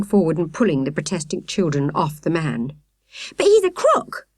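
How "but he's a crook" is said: put-on much higher, loud girl's complaint; complaining. A narrator's reading. Victoria protesting to the sergeant, high complaint. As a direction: whine it out in protest. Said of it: "Victoria complained".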